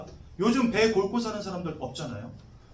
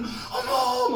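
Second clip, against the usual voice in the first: high mock squeal of wonder; exaggerated feminine exclamation; excited women passengers; 5 semitones higher, much slower than usual. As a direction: imitate women gasping at the scenery. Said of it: "근데 이분들은".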